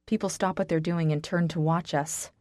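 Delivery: ordinary delivery, nothing marked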